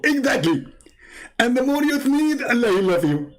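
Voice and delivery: high squeaky put-on voice; mock Zakir Naik. Caricature caller lectures the host, high and squeaky. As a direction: preach it like a cartoon. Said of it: "I'm Zakir Naik".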